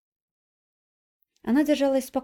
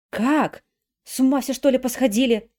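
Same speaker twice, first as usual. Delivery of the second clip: loud, higher, incredulous outburst; indignant; 4 semitones higher, 7 dB louder than usual. Acted. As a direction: burst out in disbelief. Nadezhda's irritated retort. (возмущённо)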